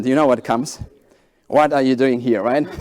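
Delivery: usual speaking voice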